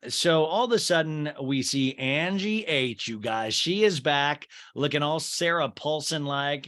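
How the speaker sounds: ordinary talk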